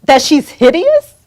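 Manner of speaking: righteous scolding on someone's behalf. Pretend. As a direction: tell them off in outrage, punching key words. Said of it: "go over there and curse them out".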